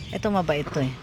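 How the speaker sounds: ordinary delivery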